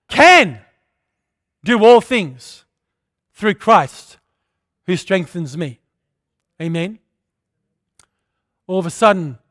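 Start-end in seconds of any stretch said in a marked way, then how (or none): none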